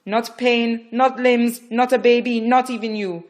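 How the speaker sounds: loud, high, driving orders; fierce commanding. Acted. Midwife commanding Rachel to push, fierce. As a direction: pound it into her.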